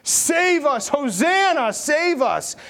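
high, loud crowd cry; shouting out. Acted. Preacher voices the palm-waving crowd, shouting out. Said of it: "the people are shouting out".

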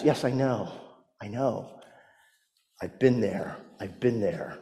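soft, low, gentle comfort; tender reassurance. Acted. Jesus comforts a suffering believer, soft and tender. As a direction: murmur it like a consoling friend. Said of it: "he can say back to us".

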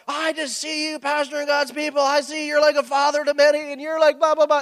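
high, sing-song prophetic voice; guest speaker prophesying. Retold or imitated. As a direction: parody the repetitive prophet. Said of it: "every single guest speaker ... and go".

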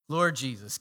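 normal talking voice

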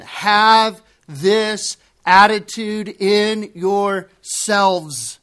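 slowest, most insistent reading; very slow emphatic reading. Shown as a demonstration. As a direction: stretch every word like a command. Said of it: "Read it again".

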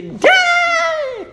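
put-on very high, loud toddler yell; drawn-out piercing mock shriek; imitated child's scream. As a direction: mimic a little kid screaming for a parent. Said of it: "she screams".

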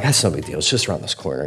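retold casual dismissal under the breath; downplaying. Talking himself down, retold.

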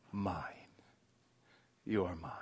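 breathy hushed "mine"; whispered. Acted. God claiming every broken part of you, in a whisper.

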